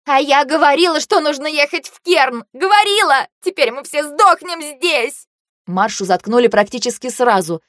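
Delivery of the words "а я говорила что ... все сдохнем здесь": high, wailing accusation; frantic high-pitched I-told-you-so; hysterical reproach. A narrator's reading. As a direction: wail it out in panic. Marsha's hysterical reproach, then silenced.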